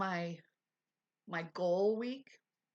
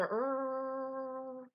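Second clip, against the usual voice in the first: effort grunt; 5 semitones higher than usual. Retold or imitated. Noise she makes washing hair.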